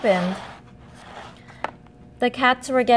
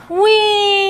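gasping high whee; excited whee; 11 semitones higher, 7 dB louder than usual. A narrator's reading. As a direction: gasp then a high, loud squeal of delight. Ice cream lands on Keith.

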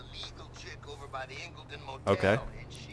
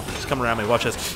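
ordinary talk

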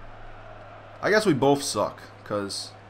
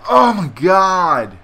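slow outraged shout; 2 semitones higher, 9 dB louder, much slower than usual. Real, not acted. Conceding the first goal.

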